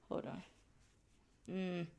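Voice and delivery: breathless, panting between words; out of breath. Real, not acted. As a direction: talk while catching your breath. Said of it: "hear when I'm out of breath".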